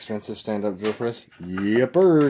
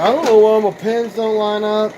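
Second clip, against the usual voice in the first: mock complaining quote; 8 semitones higher, 9 dB louder than usual. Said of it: "People are like".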